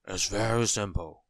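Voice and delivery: lightly voiced tramp, somewhat loud; kindly tramp. A narrator's reading. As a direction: explain reassuringly as a friendly vagrant. Kindly tramp gives advice.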